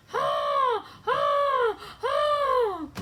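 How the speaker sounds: high, loud trumpeting ha; shouted laughing whoops; elephant laugh. Acted. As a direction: blast each ha like a trumpeting elephant. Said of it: "her elephant-like laugh".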